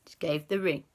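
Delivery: usual speaking voice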